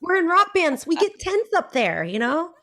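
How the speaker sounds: high, loud mock coach scolding; coach's voice. Singer imitates her vocal coach correcting her neck tension, high and loud.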